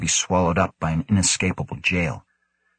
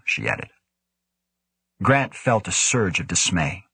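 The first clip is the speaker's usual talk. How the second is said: ordinary delivery, nothing marked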